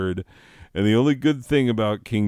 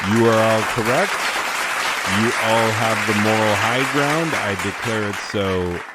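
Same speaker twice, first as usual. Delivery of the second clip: mock royal decree; 3 semitones higher, 4 dB louder than usual. Grandly addressing applauding audience.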